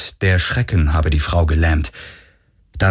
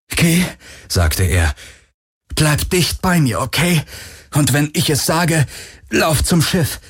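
urgent; 7 semitones higher than usual. Acted. Garland urging Kirai.

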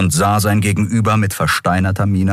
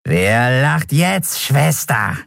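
gloating creature; 5 semitones higher, much slower than usual. Acted. Gloating skeleton taunts Endriel.

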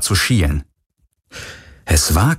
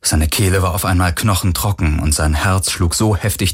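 usual speaking voice

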